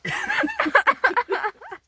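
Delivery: a loud, high burst of laughter; real loud laughter. Real, not acted. Laughing at the dog.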